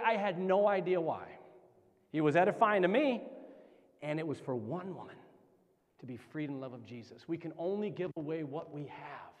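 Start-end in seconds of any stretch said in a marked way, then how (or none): 4.0-9.3 soft, low, solemn landing; quiet, weighty preacher's point; hushed sincerity. Real, not acted. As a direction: drop right down and let it sink in. Hushed moral after the climax.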